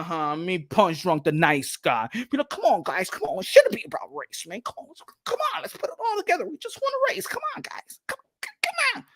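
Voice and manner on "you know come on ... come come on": very high, thin pleading squeak; mock pleading. Nice guy begging everyone to get together, mock pleading. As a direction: plead in a climbing falsetto, softer and softer.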